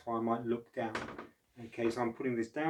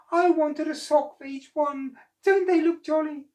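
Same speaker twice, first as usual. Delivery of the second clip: very high, louder proud creature voice; happy octopus; 9 dB louder than usual. Acted. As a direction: show off the tentacles gleefully.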